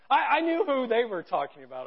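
normal talking voice

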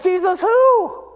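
high, loud, drawn-out mock bafflement; feigned ignorance. Peter denying Jesus to the girl, high mock bafflement. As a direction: play dumb with a slow, high-pitched question. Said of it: "And Peter's like".

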